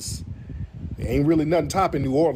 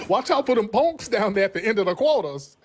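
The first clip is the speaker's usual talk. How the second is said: offended New Orleans local; 7 semitones higher than usual. Retold or imitated. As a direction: scold like an offended New Orleans auntie, high and stretched. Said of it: "just tell 'em you gonna eat in the French Quarters".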